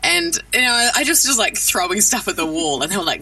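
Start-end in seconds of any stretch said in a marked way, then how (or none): none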